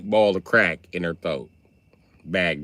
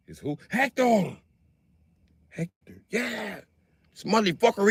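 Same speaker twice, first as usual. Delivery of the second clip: high gossiping suspect voice; 10 semitones higher than usual. Retold or imitated. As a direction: whisper warning about Hector. Suspects talking about Hector.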